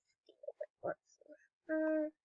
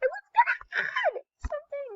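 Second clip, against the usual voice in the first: high shouted exclamation; excited shout. Real, not acted. Streamer shouting excitedly at the trade. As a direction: yell it out in excitement.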